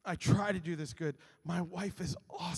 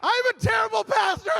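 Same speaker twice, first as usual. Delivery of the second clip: high, loud mock wail; hammed-up confession yelled at full pitch; despairing shout; 10 dB louder than usual. Pastor comparing himself to his wife, despairing shout. Comic confession after wife's story.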